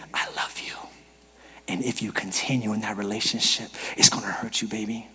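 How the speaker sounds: hushed, low, breathed warning; tender whisper. Acted. God warning someone he loves about a relationship, whispering. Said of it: "The reason why God whispers".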